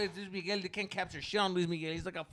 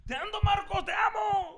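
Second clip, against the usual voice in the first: much higher, hammed-up adoring cry; gushing fan; 5 dB louder than usual. Mocking a friend gushing at the singer, high and hammed-up. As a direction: gush it like a starstruck fan. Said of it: "he's gonna be like".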